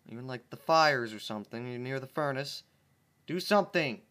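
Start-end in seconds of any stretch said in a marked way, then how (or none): 3.3-4.0 louder, higher cry for help; urgent plea. Real, not acted. Panicked plea to the friend reading along. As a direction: shout it at your friend to act. Begging his friend to act.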